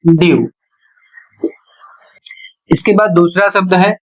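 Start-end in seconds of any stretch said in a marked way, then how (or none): 0.0-0.5 ड्यू said as "dyoo", glide into a long u; ड्यू clearly, d then yoo; model pronunciation. Shown as a demonstration. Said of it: "इसका उच्चारण होगा". (आदर्श उच्चारण: ड्यू, "ड्यू" की तरह)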